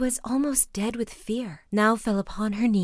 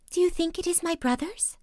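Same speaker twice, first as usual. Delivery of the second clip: much higher, anxious hope; hopeful wife; 9 semitones higher than usual. A narrator's reading. Wife asking her sister about the brothers, high voice. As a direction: ask with a catch of hope.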